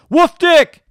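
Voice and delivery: shouting it out high and loud; mock yell of a silly name at the credits. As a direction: bellow it at the TV screen. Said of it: "I just yell at".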